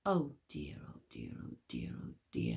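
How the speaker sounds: breathless whispered fretting; worried whisper. Acted. Wish frets silently to herself, whispering. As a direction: fret under your breath. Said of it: "thought Wish".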